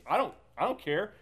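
acted careless impatience, punched out; dismissive Esau. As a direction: toss it away impatiently, raising the voice. Acting out Esau dismissing his birthright.

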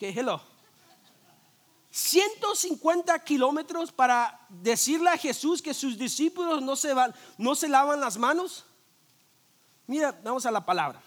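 ordinary talk

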